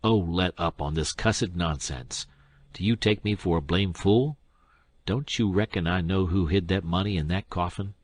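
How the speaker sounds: ordinary delivery, nothing marked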